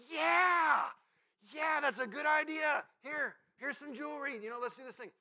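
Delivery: hammed-up high, shouted enthusiasm; over-eager agreement. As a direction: shout it like a crowd jumping on board. Acting out the crowd eagerly agreeing.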